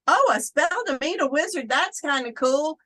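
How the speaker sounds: high, loud, quick mock wonder; retold excitement, pitch jumping up; delighted discovery. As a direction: re-enact happily stumbling on something neat. Retells finding a spell in a book.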